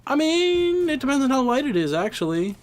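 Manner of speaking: mock nerdy high voice; high squeaky voice. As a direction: pitch way up to object. High mock-pedantic retort.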